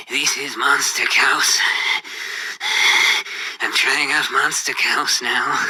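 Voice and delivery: heavy-breathing whispery voice, mouth on the mic; slow, breathy mock delivery, mispronouncing "Chaos"; breathy impression voice. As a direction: imitate an odd YouTuber breathing heavily into the microphone. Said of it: "In this sort of voice, just like that".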